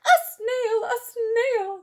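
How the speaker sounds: a teacher horrified by a snail; teacher's shriek. Acted. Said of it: "The teacher turns pale".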